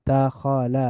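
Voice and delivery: দাখালা slowly as da, kho, la; syllable by syllable. Shown as a demonstration. Teacher breaking down the word, দাখালা syllable by syllable. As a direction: split দাখালা into three drawn-out syllables. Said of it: "একটু মিলিয়ে মিলিয়ে পড়ব".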